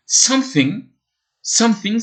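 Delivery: something said high in English; 1 English word demo. Shown as a demonstration. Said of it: "ça veut dire quelque chose".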